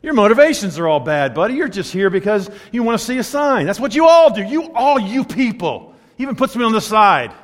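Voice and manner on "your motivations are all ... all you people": ordinary talk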